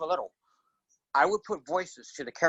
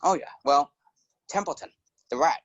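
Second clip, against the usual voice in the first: normal talking voice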